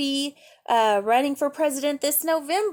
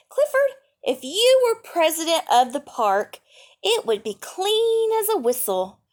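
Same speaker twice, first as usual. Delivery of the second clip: put-on high, loud child's voice; excited little girl; 7 semitones higher, 4 dB louder than usual. A narrator's reading. Girl tells her dog he'd keep the park clean, high and loud. Girl character speaks to Clifford.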